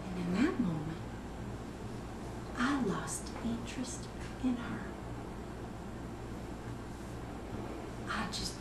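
soft, near-whisper with long pauses; hushed confession. Acted. Mother confessing what she did to her daughter, hushed.

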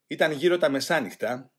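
usual speaking voice

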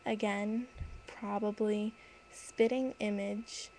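ordinary delivery

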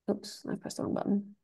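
soft, breathy mutter to herself; barely voiced, a private oops; muttered aside. Real, not acted. Lecturer mutters to herself after hitting the wrong button, softly. Muttered slip while stopping slides.

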